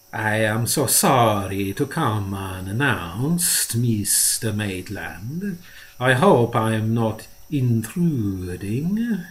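slow, unctuous voice of a greasy little man; an oily, fawning visitor apologizing with mock politeness; oily fat dealer. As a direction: ooze each word out ingratiatingly. Marco's oily voice held.

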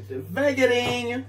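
slowly, drawn out. Shown as a demonstration. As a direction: draw out devagarinho, lilting up high. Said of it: "Slow, slow".

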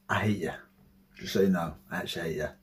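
low, hushed deadpan; quiet grudge. Real, not acted. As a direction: say it softly and low to whoever requested this. Addressing whoever requested the video.

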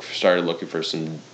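ordinary delivery

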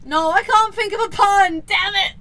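loud, high frustrated cry; exasperated shout, half laughing. Real, not acted. Frustrated, can't think of pun.

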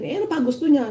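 high exasperated question; incredulous question. Retold or imitated. Chief asking what the mother still wants, high incredulous. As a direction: pitch the question way up in disbelief. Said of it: "Sabi ganun".

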